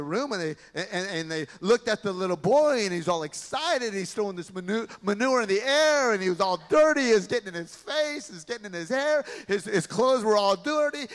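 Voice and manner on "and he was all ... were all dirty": usual speaking voice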